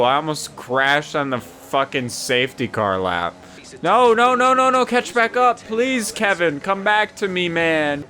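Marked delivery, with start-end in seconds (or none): 3.8-8.0 panicked pleading. Real, not acted. Losing car, begging to catch up.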